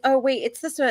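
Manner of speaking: lightly acted, high-pitched young helper; retold childhood reply. Sister answering her brother from the notebook, lightly acted high voice.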